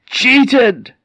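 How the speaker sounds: high, loud single-word wail; shocked outcry. Acted. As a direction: cry the word out as you fall. Spirit repeats as it collapses.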